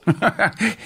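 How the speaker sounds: short jolly chuckle; hearty laugh. A narrator's reading. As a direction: let out a warm conductor's chuckle. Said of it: "Mel laughed".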